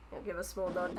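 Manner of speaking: normal talking voice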